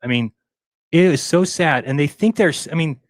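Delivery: normal talking voice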